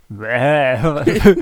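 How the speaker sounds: drawn-out mock bleat; imitated bleat. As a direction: imitate a baffling sheep noise, amused. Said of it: "Ich konnte das erst nicht verorten".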